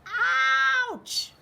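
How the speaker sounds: high, loud yelp; bunny yelping at the quills; pained scream. A narrator's reading. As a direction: cry out as if pricked. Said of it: "he screamed".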